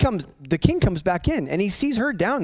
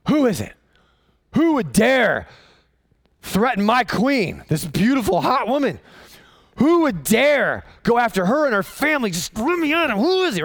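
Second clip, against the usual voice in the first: indignant king; 7 semitones higher than usual. Acted. The king demands who threatened his queen, blustering outrage. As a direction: bluster like a dim king ready to fight.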